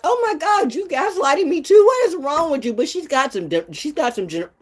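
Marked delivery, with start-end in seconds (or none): 0.0-2.7 high-pitched, theatrical disbelief; acted indignant outcry. Retelling the moment of realising the gaslighting, high indignation. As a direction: exclaim it high, mock-appalled.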